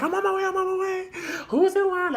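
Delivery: high, fast excited friend voice; rushing, breathless reassurance; hurried friend. Retold or imitated.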